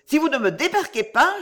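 high-pitched urgent warning; frightened threat. A narrator's reading. Girl demands to be put ashore, frightened threat. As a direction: warn him in alarm.

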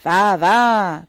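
loud, impatient dismissal; fed-up guard calling out loudly; irritated shout. A narrator's reading. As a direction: wave her through with a shout. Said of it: "cria le gardien avec irritation".

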